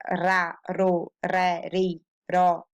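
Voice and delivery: ra, ro, re, ri, ro with a tongue-tip R; Spanish-like R. Shown as a demonstration. Teacher demonstrating middle-of-word R, ra ro re like Spanish. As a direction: tap the R in ra, ro, re. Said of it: "It's like Spanish".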